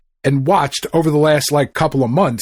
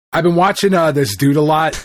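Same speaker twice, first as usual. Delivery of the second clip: ordinary delivery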